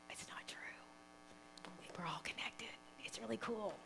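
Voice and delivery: soft, slow whispered secret; breathless whispered aside. Acted. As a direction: hush it like a revelation. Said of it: "whispered revelation".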